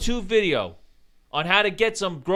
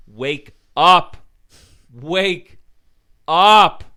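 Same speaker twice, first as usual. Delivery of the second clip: loud plea; 7 dB louder, much slower than usual. Real, not acted. Rant urging the city.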